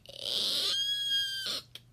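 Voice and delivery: very high squeal; frightened eek. Acted. Lion squeals high instead of roaring. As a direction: shriek like a scared lion. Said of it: "let out a".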